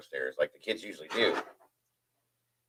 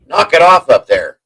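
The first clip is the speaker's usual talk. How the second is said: slow, higher, louder call upward; calling upstairs; 8 semitones higher, 11 dB louder, slower than usual. Retold or imitated. Wife calls up to the kids, slow raised call. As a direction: shout it up the stairs slowly. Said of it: "she was like".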